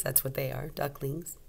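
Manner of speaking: ordinary delivery, nothing marked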